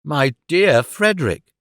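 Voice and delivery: slow, slightly faint alarmed brother; lightly voiced shaken gentleman; understated dismay; faint exclamation. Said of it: "exclaimed Mister Dorrit faintly".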